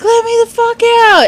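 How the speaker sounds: high, loud reenacted plea to escape; mock-panicked outcry; retold desperate yell. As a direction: holler to be let out, replaying the moment. Retelling plea to escape derby car.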